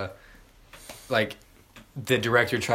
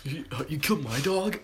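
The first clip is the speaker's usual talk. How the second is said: higher, put-on dazed dude with a chuckle; Keanu-style Joker; 7 semitones higher than usual. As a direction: play a bewildered action hero turned villain. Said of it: "impression of what we think Keanu Reeves' Joker laugh".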